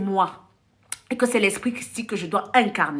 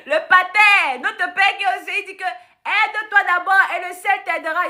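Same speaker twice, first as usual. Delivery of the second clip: laughing retelling; 8 semitones higher than usual. Retold or imitated. Retelling the pater's reply, laughing.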